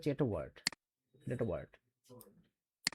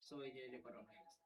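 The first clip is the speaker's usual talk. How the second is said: recorded playback; 21 dB quieter, faster than usual. Shown as a demonstration. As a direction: voice played back from a phone recorder. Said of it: "So এটা যদি play করি". (Recorder থেকে বাজানো কণ্ঠ)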